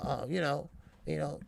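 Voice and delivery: ordinary talk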